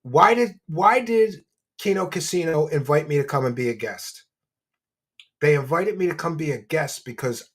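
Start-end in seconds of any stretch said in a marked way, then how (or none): none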